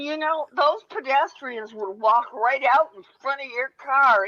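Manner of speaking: ordinary talk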